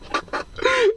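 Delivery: a sudden high burst of laughter; amused outburst of laughing; real laugh. Real, not acted. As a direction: crack up for real. Laughs at Ryan Reynolds scene.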